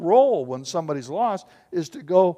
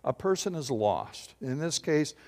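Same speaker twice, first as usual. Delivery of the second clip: ordinary talk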